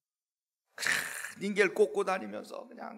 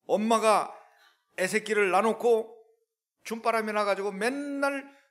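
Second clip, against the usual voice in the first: forceful loud preaching; fiery preaching; 6 semitones higher, 8 dB louder than usual. Acted. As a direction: preach it with heat.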